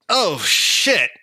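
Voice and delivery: loud, high-pitched "oh" of surprise; acted startled outburst. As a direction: react as if caught off guard mid-work. Startled smith notices the visitors.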